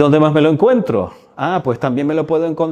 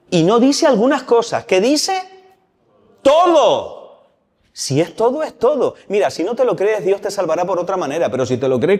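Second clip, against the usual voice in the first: fervent preaching; 7 semitones higher than usual. Real, not acted. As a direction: preach it with urgent conviction. Predicación exaltada sobre la fe. (predicando con fervor, más agudo y fuerte)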